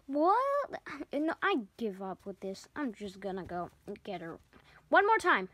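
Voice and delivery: ordinary delivery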